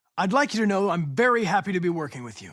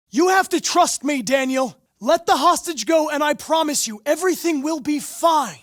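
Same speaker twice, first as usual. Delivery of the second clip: high, loud promise called across; urgent plea; 9 semitones higher, 5 dB louder than usual. Acted. Negotiator urging the gunman to free the hostage, loud earnest plea. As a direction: press him to let her go, loud and earnest.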